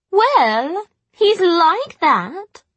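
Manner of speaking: high, loud, slow drawl; offhand retort. A narrator's reading. Jessamine shrugging off the rebuke, offhand. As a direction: draw it out high, with a verbal shrug.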